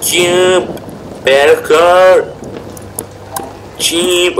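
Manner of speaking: excited. Real, not acted. Playful parkour jumping callouts.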